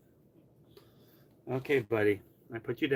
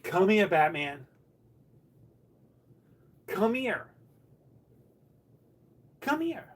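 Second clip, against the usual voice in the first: high, slow coaxing call; calling the cat; 6 semitones higher, 3 dB louder, slower than usual. Real, not acted. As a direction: lure the cat over.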